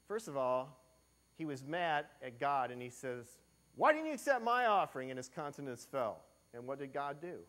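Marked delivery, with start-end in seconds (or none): 3.7-5.1 higher, louder, fast indignant demand; angry outburst. Acted. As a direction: hurl the question at God. Angry Cain voiced.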